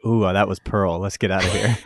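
mock scared thief. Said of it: "their response is like".

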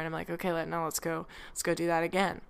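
ordinary talk, nothing marked

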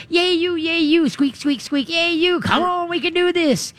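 cheerleading a scared dog through it; cheerleader. Shown as a demonstration. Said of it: "you become the cheerleader".

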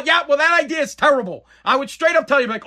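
ordinary talk, nothing marked

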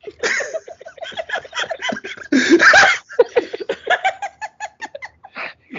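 loud high burst of laughter; howling laugh; big laugh. Real, not acted. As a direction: break into a long real laugh. Cracking up at the scene.

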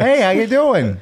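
high-pitched mock New York accent; gangster New York accent. Host botching Midwest talk, mock New York accent. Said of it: "now that's gangster".

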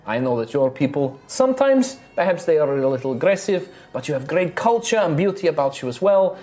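bubbly innkeeper charming her guests; cheerful halfling innkeeper. Acted. GM voices innkeeper Rosalina.

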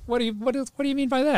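high-pitched, startled questioning; alarmed disbelief. Retold or imitated. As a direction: stammer out a baffled, alarmed question. Reacting to the mechanic's alarming warning.

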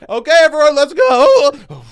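very high, shouted class call; rally the class and let the voice break; hammed-up cracking coach shout; mock cracking voice. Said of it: "not only is your voice cracking".

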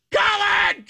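very high, squealed name call; imitated scream. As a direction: shriek for your husband, played for laughs. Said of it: "I'm screaming".

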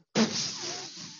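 high whooshing burst of mouth noise; imitated flare-up noise. Storyteller imitating the burning pan he holds, whooshing. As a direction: imitate flames flaring with a high puff. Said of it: "and it was like".

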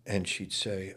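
ordinary talk

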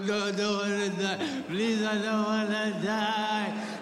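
slow, drawn-out mock wail; panicked wailing. Driver stuffed back through window by officer, wailing. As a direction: beg in a long sing-song cry. Retold driver begging for his life.

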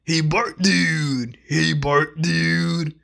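slow, stoned surfer drawl; Otto impression. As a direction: drag out the words like a stoner bus driver. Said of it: "Here's Otto from The Simpsons".

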